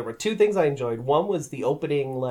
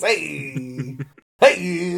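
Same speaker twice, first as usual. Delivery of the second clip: the Thing voice. Retold or imitated. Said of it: "the classic Thing catchphrase".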